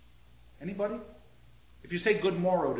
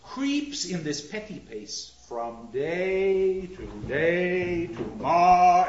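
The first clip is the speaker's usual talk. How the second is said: slow, louder drawn-out ending; slow, swelling toward the end; long drawn out; 4 dB louder, slower than usual. Shown as a demonstration. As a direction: stretch the last words long and full. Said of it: "back in that long drawn out sound".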